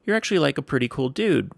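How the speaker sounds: lightly voiced coworker, pitched high; imitating a guy. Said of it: "one of the guys finally says".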